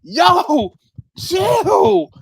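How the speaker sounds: loud, high-pitched mock yell; exasperated yell. Nearly fighting in the store, high exasperated yell. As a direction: holler it high, one word at a time.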